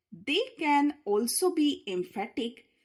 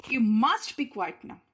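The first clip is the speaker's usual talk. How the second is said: "must" punched, a bit louder; stress on must; 4 dB louder than usual. Shown as a demonstration. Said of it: "Emphatic imperative".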